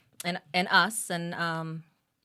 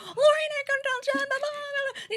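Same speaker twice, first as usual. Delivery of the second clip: much higher and quicker, breathless gush; gushing rush. Retold or imitated. Mother telling her husband the news, fast high gush. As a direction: rattle it off thrilled. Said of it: "my conversation was".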